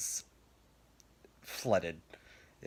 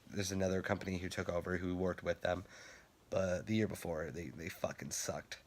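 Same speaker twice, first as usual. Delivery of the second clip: ordinary talk, nothing marked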